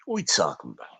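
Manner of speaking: low, soft New York or Philly catchphrase. Retold or imitated. Streamer returning to the topic with quoted catchphrase, mock New York voice. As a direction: murmur the catchphrase in a Philly accent. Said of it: "I'm doing it in that New York voice".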